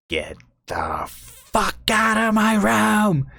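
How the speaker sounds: high, drawn-out strained screech; angry old lady. Retold or imitated. Old lady ordering a visitor out, furious mock screech. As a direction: imitate an enraged resident, slowly stretching the words. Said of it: "just the most angry, pissed off person ever".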